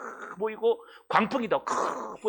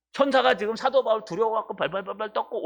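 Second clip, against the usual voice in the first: normal talking voice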